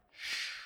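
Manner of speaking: soft voiceless shh; whispered hush. Acted. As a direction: hush the room with one breath. Silence demanded at the tomb.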